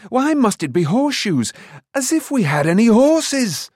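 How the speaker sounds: loud, high-pitched protest; indignant cry. A narrator's reading. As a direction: cry out in exasperated complaint. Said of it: "Taran cried".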